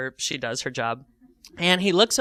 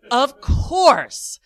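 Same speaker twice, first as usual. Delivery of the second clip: usual speaking voice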